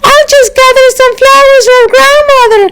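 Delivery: put-on high sweet little girl voice; a small girl chirping up at the wolf. Little girl answers the wolf, high and sweet.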